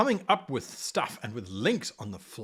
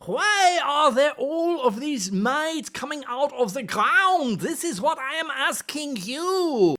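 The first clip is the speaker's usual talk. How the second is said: higher, put-on curious player asking the GM; 7 semitones higher than usual. Teacher plays a puzzled player asking the GM. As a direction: play the puzzled player pressing for answers. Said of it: "Because I am the player".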